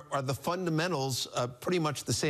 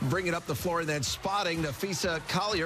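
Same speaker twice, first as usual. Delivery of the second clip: upbeat, higher broadcast read of the sponsored highlight; announcer sponsor read; 5 semitones higher than usual. Acted. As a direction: pitch it like a highlight promo.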